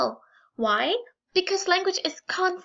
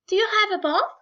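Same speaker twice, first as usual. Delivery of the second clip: "Do you have a bath?" raised high like a posh speaker; mock upper-class asking "Do you have a bath?"; upper-class phrasing; 6 semitones higher, faster than usual. Shown as a demonstration. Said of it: "the U and the non-U".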